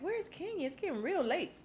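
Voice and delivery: high, mock impatient question; mock impatience. Asking the party where Kenya is, high mock impatience. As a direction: pitch it up, fake concern about the late guest. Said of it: "Cynthia asks".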